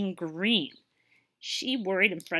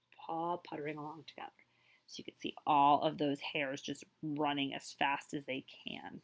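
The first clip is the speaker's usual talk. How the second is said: whisper; 5 semitones lower, 6 dB quieter than usual. A narrator's reading. Storyteller hushes the telling.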